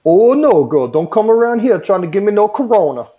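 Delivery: high, loud mock Louisiana lady scolding; imitating a loud country cashier shooing someone; gas station lady. Retelling a cashier scolding a coughing customer, in a high loud mock voice. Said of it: "And she was like".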